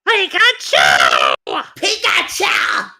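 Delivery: squeaky aggressive creature cries; Pikachu voice. Pretend. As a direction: shout it like an angry cartoon critter. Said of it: "The clone Pikachu with a cutesy voice, but is also very aggressive".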